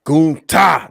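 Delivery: loud, high, drawn-out roar of a name; Klingon bellow. Shown as a demonstration. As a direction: shout GUNTHER like a Klingon warrior. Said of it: "you gotta say it like".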